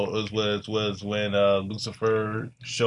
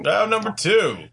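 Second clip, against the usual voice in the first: higher and louder announcement; announcer echo; 9 semitones higher, 4 dB louder than usual. Pretend. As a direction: boom it like a show host.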